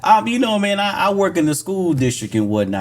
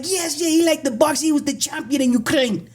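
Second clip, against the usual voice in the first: high-pitched mock Ukrainian accent; imitated Ukrainian mother; 11 semitones higher than usual. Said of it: "his mom was like".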